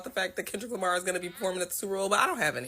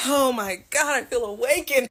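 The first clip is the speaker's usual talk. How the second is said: louder and higher with joy; thrilled, giddy exclamation; excited; 5 semitones higher, 6 dB louder than usual. Real, not acted. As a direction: burst out elated. Gleeful reaction to [name] news.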